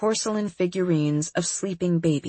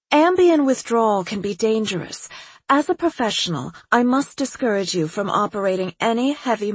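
higher, breezy, self-assured psychiatrist; eccentric doctor voice; 5 semitones higher than usual. Acted. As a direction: play her as a cheerfully odd doctor. Doctor keeps talking on phone.

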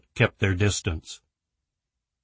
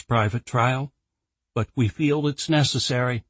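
raised, earnest formal request; 4 semitones higher than usual. Acted. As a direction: press the board politely. Claire requests approval.

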